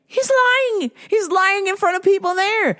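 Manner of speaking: very high, squealed protest; incredulous outburst. Real, not acted. Podcaster exposing a fighter's lie, high incredulous outburst. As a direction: cry it out high-pitched in disbelief. Outraged rant about fighter's claim.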